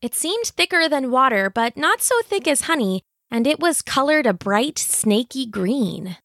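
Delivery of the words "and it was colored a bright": ordinary delivery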